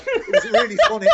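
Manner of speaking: real laughter at a funny memory; laughing. Real, not acted. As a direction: giggle the whole line out. Cracking up at the memory.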